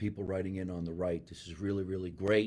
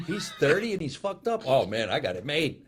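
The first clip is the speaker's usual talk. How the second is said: higher, giggling through the quote; laughing mock fan; 6 semitones higher, 6 dB louder than usual. Imagining viewers reacting to the guest, laughing. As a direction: toss it off laughing. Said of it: "people going".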